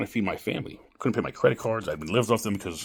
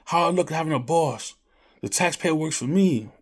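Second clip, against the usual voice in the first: higher, louder bragging quote; mock entitled guy; 7 semitones higher, 4 dB louder than usual. Retelling a man who refuses to work, higher mock brag. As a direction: play the smug guy, pitched up. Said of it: "That's one guy's like".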